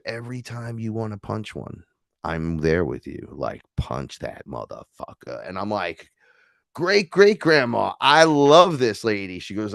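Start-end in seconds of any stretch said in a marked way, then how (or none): 0.1-5.5 put-on low, quiet urging old woman; low hushed ancestor. Ancestor voiced urging him. 6.7-9.4 high, slow delighted gush. Retold or imitated. Gushing to great-grandmother.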